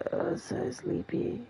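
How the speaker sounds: breathy, drawn-out sleepy whisper. Pretend. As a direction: whisper it like you're nodding off. Teasing a sleepy friend.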